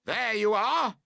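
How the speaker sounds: high, loud told-you-so call. A narrator's reading. Both campers yelling at each other over the fallen tent, triumphant. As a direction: shout it in triumph. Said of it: "you both call out".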